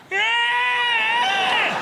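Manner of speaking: long, high, loud squeal; comic scream. Retold or imitated. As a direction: imitate a sustained high-pitched wail for help. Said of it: "It wasn't an audible scream".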